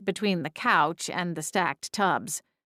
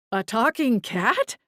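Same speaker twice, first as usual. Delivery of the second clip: surprised exclamation; 5 semitones higher than usual. A narrator's reading. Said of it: "Garth exclaimed".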